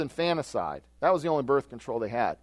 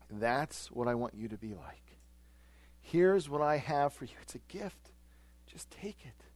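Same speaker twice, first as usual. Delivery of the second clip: much softer, gentle giving voice; tender offer; 7 dB quieter than usual. Retold or imitated. Jesus gently offering his gift to believers, tender and soft. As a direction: hand it over kindly, almost hushed.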